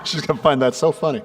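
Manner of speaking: normal talking voice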